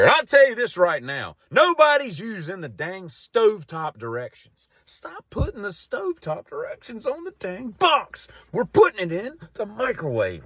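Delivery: high-pitched, exasperated comic rant; indignant rant. Real, not acted. Comedian railing at stovetop directions on the box, indignant rant.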